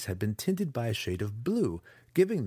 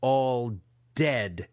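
louder villain snarl; 2 semitones higher, 4 dB louder than usual. A narrator's reading. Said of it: "he snarled one more time".

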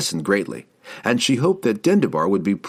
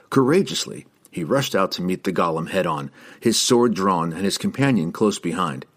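ordinary delivery, nothing marked

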